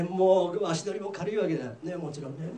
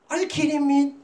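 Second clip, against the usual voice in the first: loud, higher acted outburst; angry disbelief; 7 semitones higher, 6 dB louder than usual. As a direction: play an outraged creditor. Creditor angrily refuses friend.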